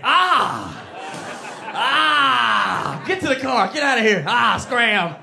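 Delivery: loud, high frantic shouting; panicked shooing. Retold or imitated. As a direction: shoo her out in a panic. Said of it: "I was like".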